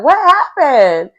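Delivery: startled question, drawn out; surprised. Retold or imitated. Date asking why he winced, surprised and slow. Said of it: "she's like".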